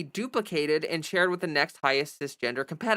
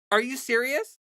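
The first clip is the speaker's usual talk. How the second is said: incredulous; 7 semitones higher than usual. Real, not acted. Disbelief at Kirk quote.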